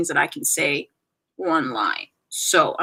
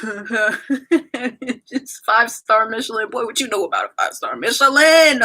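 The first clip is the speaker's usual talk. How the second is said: mock-aggressive idol imitation with a little twang; hammed-up, slightly aggressive twang; slower than usual. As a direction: laugh into it, then stretch it out with playful swagger. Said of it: "You just get a little aggressive. It's just like a little twang".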